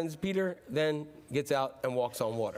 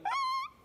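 very high, thin "ah"; hammed-up squeal of fear; mock frightened wail; 4 dB quieter than usual. Disciples still screaming in fear.

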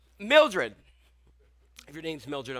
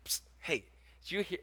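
hushed, secretive psst; gossipy whisper; 2 semitones lower, 14 dB quieter than usual. Retold or imitated. Church gossip leaning in to whisper a rumour.

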